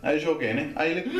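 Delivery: ordinary delivery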